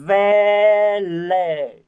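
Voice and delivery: "Village" loud and stretched to show the ending; corrected village. Shown as a demonstration. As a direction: model "Village" firmly and clearly. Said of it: "em vẫn đang lỗi cái từ này". (nhấn to và kéo dài "Village" để sửa lỗi)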